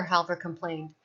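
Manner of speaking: ordinary delivery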